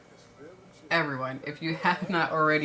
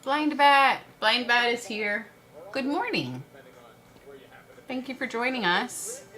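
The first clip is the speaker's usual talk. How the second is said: high, loud call-out easing in volume; excited greeting; 10 semitones higher, 5 dB louder than usual. Real, not acted. Streamer welcomes Blind Bat to the stream, excited greeting. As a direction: sing out a delighted welcome.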